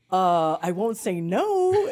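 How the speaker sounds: slow, loud, drawn-out yes-please; overwhelmed fangirl answer, hammed up; acted giddy acceptance. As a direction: stretch it out, barely containing the thrill. Acts overwhelmed answer to invitation.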